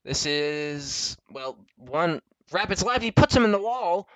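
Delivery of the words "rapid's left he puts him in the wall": raised, louder race commentary; excited crash call. Real, not acted. Commentator seeing a driver put in the wall, excited. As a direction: call the hit with a jolt of alarm.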